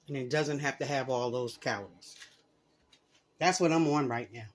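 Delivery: talking through a mouthful; words round a bite of food; mouth full. Real, not acted. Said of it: "Sorry to be talking with food in my mouth".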